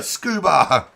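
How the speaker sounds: loud, high, stretched name call; announcer-style holler; drawn-out shout. Real, not acted. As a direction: belt out the driver's name, dragging it out.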